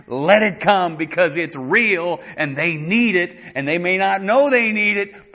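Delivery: loud, high, insistent exhortation; impassioned preaching. Real, not acted. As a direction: drive it home to the congregation at full voice.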